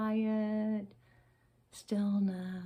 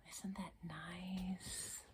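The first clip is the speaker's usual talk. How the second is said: soft, slow whispered question; 8 dB quieter, much slower than usual. Shown as a demonstration. Teacher whispering to settle children, slow and hushed. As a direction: hush it slowly. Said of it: "settling children".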